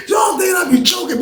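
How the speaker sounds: bursting into high laughter mid-sentence; laughing. Real, not acted. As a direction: laugh it out, almost shouting. Laughing at his own story.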